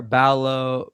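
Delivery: usual speaking voice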